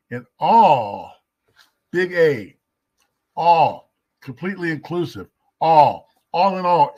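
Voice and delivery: rising preacher emphasis on all; emphatic preaching. Real, not acted. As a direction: hammer the word all, loud and high. Preacher hammering the word all.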